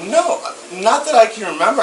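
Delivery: high, loud startled denial; shocked denial. Real, not acted. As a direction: blurt it out in real shock. Accused of filming, protests loudly.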